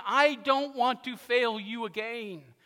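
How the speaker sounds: high, loud, slow plea; acted anguished vow, drawn out; Peter, broken. Peter promising Jesus after failing him, broken. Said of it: "In his broken way".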